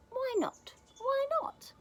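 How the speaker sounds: put-on very high little child voice; Gruffalo's child. Child questions Gruffalo's warning, high little voice.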